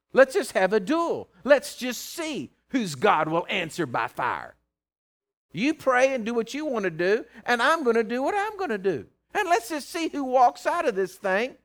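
loud, high dare to a rival; preacher calling out a showdown; bold challenge. Acted. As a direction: throw down the gauntlet from the pulpit. Issues a challenge to rival believers.

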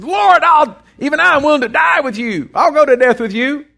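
high, loud pledge of loyalty; earnest vow. Acted. Peter pledging to die with Jesus, loud and earnest. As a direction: swear it with full conviction.

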